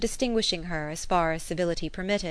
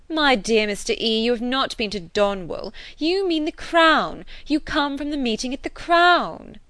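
lightly voiced lady correcting her husband; a hint of knowing condescension; Mrs Elton; 6 semitones higher, 5 dB louder than usual. A narrator's reading. Mrs Elton setting her husband right, lightly voiced.

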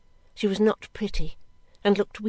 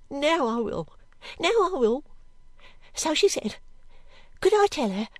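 higher voice of a shaken maid; anxious servant girl; 5 semitones higher than usual. Acted. Girl explaining how she took the letter, still shaken. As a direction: recount it earnestly in short, plaintive phrases.

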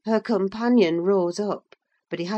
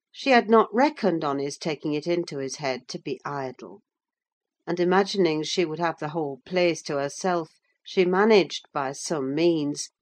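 ordinary delivery

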